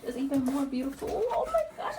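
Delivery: climbing into a high squeal of delight; gushing delight. Real, not acted. Vlogger seeing the pink machine up close, squealing with delight. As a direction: let the joy push the voice way up.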